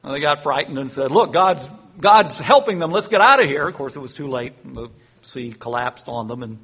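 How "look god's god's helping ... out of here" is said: loud, alarmed cry to retreat; mock panicked retreat, louder; panicked Egyptians. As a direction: shout it like soldiers in a panic. Frightened Egyptians flee.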